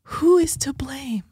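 hushed dramatic question; whisper. Real, not acted. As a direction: whisper it like a mystery.

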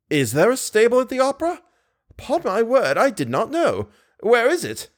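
high-pitched, surprised manager; astonished manager. A narrator's reading. Manager learns of the opera's stable, high astonished voice.

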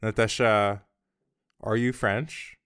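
ordinary talk

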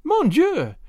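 high French cry of alarm; drawn-out, high feigned alarm; loud cry. A narrator's reading. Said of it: "with a loud cry".

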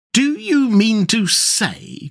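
high, drawn-out incredulous cry; excited. A narrator's reading. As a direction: stretch each word in agitated disbelief. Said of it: "cried the excited rat".

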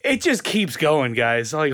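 normal talking voice

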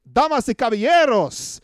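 loud, high Spanish-style announcer. Pretend. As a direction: boom it out like a Spanish ring announcer. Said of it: "That's my Spanish".

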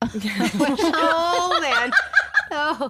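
high, loud laughing exclamation. Real, not acted. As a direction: burst out laughing mid-word. Laughing at the married joke.

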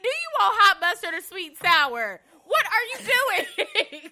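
high, loud, incredulous outburst; mock exasperation. Mock drive-thru exchange, exasperated.

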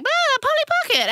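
very high silly squeal; hammed-up self-parody. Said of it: "I'd be like".

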